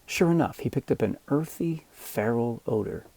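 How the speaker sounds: ordinary delivery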